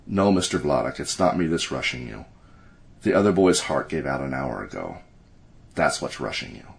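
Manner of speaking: low, soft, assured delivery; calm and assured. A narrator's reading. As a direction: break hard news calmly, with quiet certainty. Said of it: "The distant voice was calm and assured".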